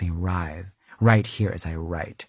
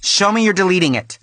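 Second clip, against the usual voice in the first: bossy demand a pitch higher and louder; 9 semitones higher, 6 dB louder than usual. Real, not acted. To the sound engineer, a demanding higher louder voice. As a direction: insist on proof, up and loud.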